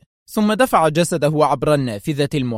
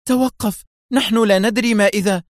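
high, louder cry to stop; urgent call; 7 semitones higher, 4 dB louder than usual. A narrator's reading. Mona calling out to stop the Russian doctor, urgent cry. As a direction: call him back from the door. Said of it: "فهتفت به منى".

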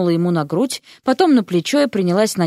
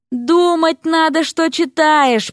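slow, higher, pained complaint; groaning; 7 semitones higher, much slower than usual. A narrator's reading. Erik groaning at wife who read the spell. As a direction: moan it in exasperation. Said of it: "простонал Эрик".

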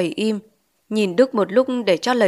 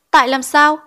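higher, louder demand for a reason; insistent question; 6 semitones higher, 5 dB louder than usual. A narrator's reading. Teacher presses pupil about the landlady, insistent question.

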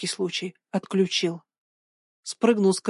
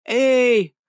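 higher hailing call; raised, drawn-out holler; shout; 4 semitones higher, 4 dB louder than usual. A narrator's reading. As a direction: call out into the distance. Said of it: "крикнул Ваня".

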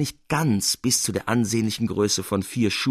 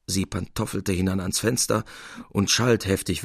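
plain reading voice

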